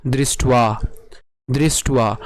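দৃষ্টুয়া উচ্চারণ, ব উ-এর মতো; pronunciation demo. Shown as a demonstration. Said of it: "তাহলে উচ্চারণ কেমন হবে".

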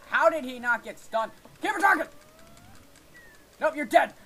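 loud, high outburst at the game; real exasperated shouting; frustrated yelling. Real, not acted. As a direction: yell in disbelief at the screen.

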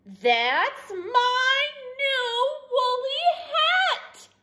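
high, very drawn-out tearful wail; crying wail. A narrator's reading. Douglas losing his hat to Rabbit, tearful high wail. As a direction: wail each word slowly and high. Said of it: "cried Douglas".

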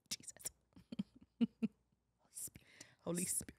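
imitating someone whispering prayers in church; hissy, very quiet church whisper; mock prayer whisper. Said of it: "in church when they be praying".

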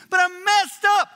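high, loud outcry; loud protest. Retold or imitated. Believer crying out to God, loud and high. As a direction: cry it out in desperation. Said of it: "God goes".

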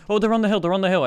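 urgent callout. Real, not acted. Player warning teammate of enemies on the hill, urgent. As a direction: call out a sighting urgently.

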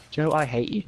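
normal talking voice

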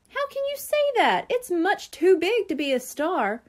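high-pitched, incredulous objection; indignant protest. A narrator's reading. Buster disputing the Brain's verdict, indignant. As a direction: protest as if it were obvious.

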